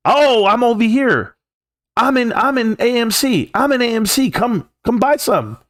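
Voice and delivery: mock bragging investor. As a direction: lure friends in like a smug show-off. Mocking someone bragging about AMC.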